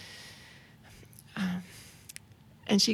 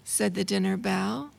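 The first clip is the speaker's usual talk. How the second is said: put-on reading to children; 2 semitones higher than usual. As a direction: read it like a picture book to a child. Reads rhyming children's book aloud.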